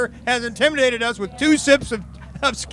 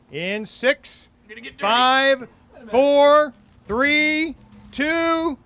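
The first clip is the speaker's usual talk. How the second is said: building countdown; 3 semitones higher, much slower than usual. Real, not acted. Official counting down the chug start, climbing to a shout. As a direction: count them down, climbing to the launch.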